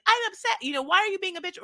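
high, loud retold blurt; mock upset outburst. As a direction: imitate a girlfriend finally snapping. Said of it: "until she was like".

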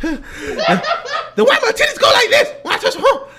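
high, loud bellowing big woman voice; Norbert impression. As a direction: yell it as a bossy, furious wife. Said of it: "let me do my Norbert".

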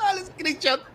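high laughter into the words; laughing. Real, not acted. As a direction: laugh through the line. Laughing at screenshot.

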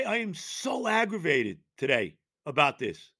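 ordinary delivery

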